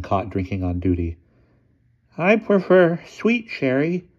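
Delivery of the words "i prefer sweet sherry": high, slow, embarrassed station master; self-conscious. A narrator's reading. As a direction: say it a little sheepishly. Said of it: "a trifle self-consciously".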